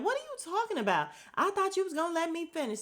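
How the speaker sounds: high, fast, indignant mock protest. [name] snapping back at [name], high fast indignation. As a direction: fire it back, high and quick. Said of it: "that's what [name] was like".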